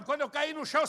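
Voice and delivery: usual speaking voice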